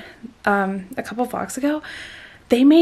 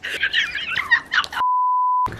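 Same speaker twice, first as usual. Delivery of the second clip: very high, squeaky giggle of joy; excited squealing laugh. Real, not acted. Vlogger thrilled by school acceptance, excited squealing laugh. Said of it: "this is so exciting".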